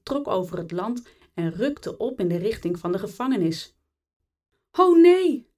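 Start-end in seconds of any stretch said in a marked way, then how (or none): 4.7-5.5 high, loud cry of dismay; much higher and louder, an urgent exclamation; alarmed cry. A narrator's reading. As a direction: call out in horror at what you see. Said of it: "riep Repelsteeltje uit".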